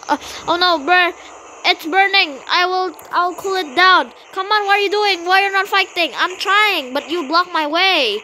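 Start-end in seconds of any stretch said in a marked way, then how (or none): none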